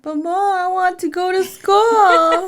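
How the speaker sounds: put-on high, loud, drawn-out kid whine; mock childish wail, stretched out; upset child. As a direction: imitate a little kid pleading in protest. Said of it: "She got upset".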